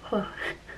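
real soft, breathy sigh of hurt; upset exhale; heavy sigh. Real, not acted. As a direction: let out a heartbroken sigh. Heartbroken pause before admitting it.

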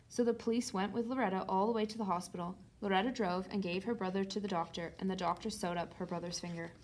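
ordinary delivery

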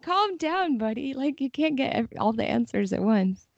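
a hint of the short-tempered captain. Translator mimicking the captain's exasperation, lightly voiced. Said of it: "It's like".

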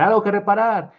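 loud, high street call; shouting wares to the street. A narrator's reading. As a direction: call out for work to fix. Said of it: "empieza a gritar".

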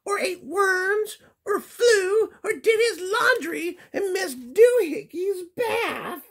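very high scolding mother voice; scolding mama. Acted. Mama cat scolding Skippy for sleeping with birds. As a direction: wag a finger in a high mom voice. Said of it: "she scolded".

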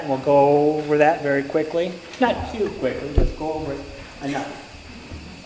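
ordinary delivery

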